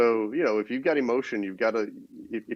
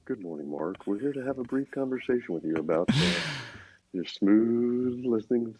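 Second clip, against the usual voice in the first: smooth radio DJ voice. Pretend. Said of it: "the late night F M D J, D J voice".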